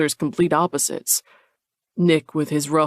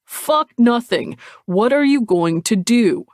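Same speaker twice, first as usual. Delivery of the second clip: loud, higher, slow on Fuck nothing; exasperated; 6 semitones higher, 6 dB louder than usual. Acted. Nick demanding answers from Cole, loud and exasperated. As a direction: push back hard and loud.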